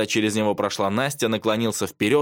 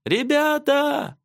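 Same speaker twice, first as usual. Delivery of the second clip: high, long-drawn shout; stretched high holler to friends; calling from afar; 3 dB louder, much slower than usual. A narrator's reading. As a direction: call slowly across a forest. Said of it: "раздалось из леса".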